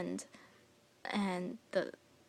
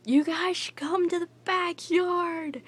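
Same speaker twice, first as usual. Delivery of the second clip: calling out loud and high across the yard; mock yell from the backyard; dad yelling; 9 semitones higher, 7 dB louder than usual. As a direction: imitate dad hollering from afar. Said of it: "my dad yells out".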